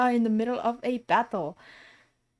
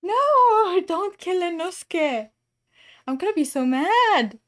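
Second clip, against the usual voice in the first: high, loud wail of protest; dismayed protest; 7 semitones higher, 4 dB louder, slower than usual. Real, not acted. Streamer pleading for Inosuke's life, dismayed outcry. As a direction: cry out against it, starting high.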